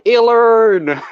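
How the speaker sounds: "Illern!" called out loud and high, foreigner style; mangled foreign Illern. Retold or imitated. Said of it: "for every other country, it's just like".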